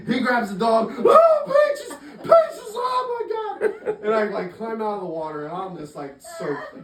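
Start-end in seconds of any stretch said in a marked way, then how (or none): 1.0-4.0 very high, squealing mock wail; hammed-up overwrought cry. Storyteller as owner grabbing rescued dog, mock overwrought wail. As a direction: squeal in exaggerated relief.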